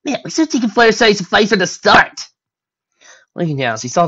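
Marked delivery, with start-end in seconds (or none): 0.0-2.3 loud, high, exuberant; brash, excited pony voice; elated. Acted. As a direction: shout it mid-celebration. Rainbow flies around celebrating.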